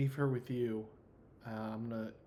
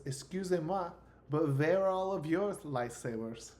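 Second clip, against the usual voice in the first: higher, hammed-up French accent; 5 semitones higher, 5 dB louder than usual. Game master voicing a girl asking about lightsabers, French accent. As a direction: put on a theatrical French accent. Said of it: "I could do this French accent".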